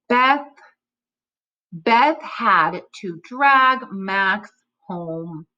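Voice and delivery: very slow, drawn-out reading; slow careful reread. Shown as a demonstration. Teacher rereads the line for the class. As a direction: stretch each word out carefully. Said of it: "rereading corrected fluency line".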